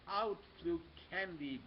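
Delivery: ordinary delivery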